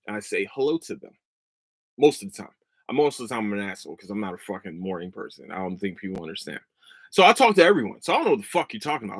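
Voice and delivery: usual speaking voice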